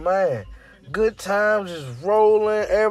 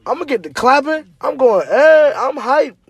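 excited; 6 semitones higher, 5 dB louder than usual. Acted. Hype man describing the party.

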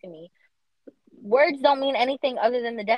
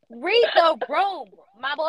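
slow, high, emphatic scolding; exasperated plea; 6 semitones higher, 6 dB louder, slower than usual. Acted. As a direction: READ THE ROOM drawn out, loud and high. Mock plea to absent man.

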